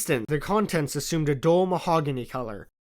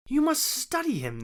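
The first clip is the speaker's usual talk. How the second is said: Stamford's voice; 12 semitones higher than usual. A narrator's reading. Stamford teasing Watson.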